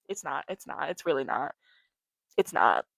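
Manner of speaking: resigned low. Real, not acted.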